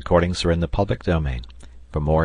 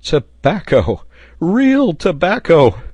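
high, loud, drawn-out exclamation; excited repeating; 10 semitones higher, 8 dB louder, slower than usual. A narrator's reading. Sailor after his first whiffs of the pipe, repeating excitedly. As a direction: cry it out slowly in amazed delight. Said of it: "a voice was heard repeating excitedly".

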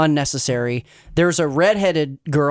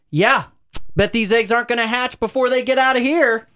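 higher, mock-wondering sarcasm; sarcastic prediction; 6 semitones higher than usual. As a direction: mockingly pretend it's in doubt. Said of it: "and I'm like".

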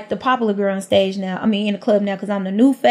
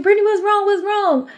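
worried friends calling; 11 semitones higher than usual. Retold or imitated. Friends run over worried.

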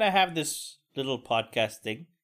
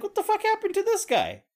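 high-pitched, incredulous inner reaction; mock bewilderment. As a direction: retell your disbelief in a squeaky high pitch. Stunned co-host's reaction retold.

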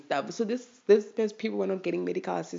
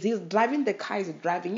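ordinary delivery

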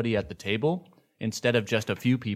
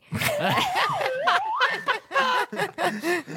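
shrieking laugh. Real, not acted. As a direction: burst out in a screaming laugh. Loud burst of laughter.

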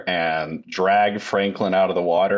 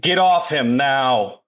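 higher, slowed, forceful order; acted commanding yell; 5 semitones higher, 4 dB louder, slower than usual. Detective ordering the attacker off the victim, acted yell. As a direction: order them off, voice raised a touch.

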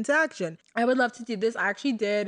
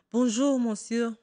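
French accent; 3 semitones higher than usual. Shown as a demonstration. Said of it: "my French accent used to be on point".

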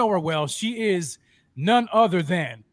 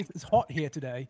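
mock British accent, a little lower; bad British accent; 3 semitones lower, 4 dB quieter than usual. Host copying guest's line about the heat, bad British accent. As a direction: teasingly imitate a British accent. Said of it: "my accent?".

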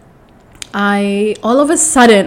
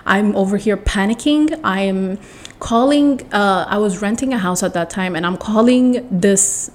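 ordinary delivery

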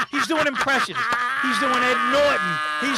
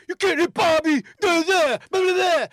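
a tough guy yelling, higher and louder; 7 semitones higher, 6 dB louder than usual. Retold or imitated. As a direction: bark it like a bar tough guy. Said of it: "that tough guy".

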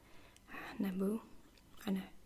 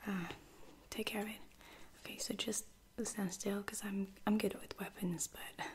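ordinary delivery

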